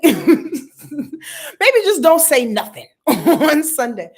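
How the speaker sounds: slow, laughing through the words; loud laughing advice. Real, not acted. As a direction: chuckle out the joke slowly.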